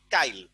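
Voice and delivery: কাইল as "kail", falling at the end; epenthetic pronunciation. Shown as a demonstration. Teacher answers own question, i pronounced early. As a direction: say কাইল with the i moved before the l. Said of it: "কালি থেকে বললাম কি?".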